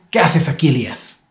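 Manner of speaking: a touch of stern anger in the question; mild angry reading of God's question. Shown as a demonstration. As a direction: read it as if God were mildly annoyed. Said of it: "con entonación de como si Dios estuviera enojado".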